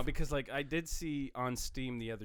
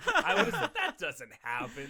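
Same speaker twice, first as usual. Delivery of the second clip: talking through laughter; words broken by laughs; laughing; 13 semitones higher, 7 dB louder than usual. Real, not acted.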